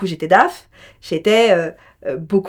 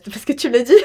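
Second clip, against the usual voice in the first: breaking into laughter while speaking; amused, laughing reply; 10 semitones higher, 6 dB louder, much faster than usual. Real, not acted. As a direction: say it through a rising laugh.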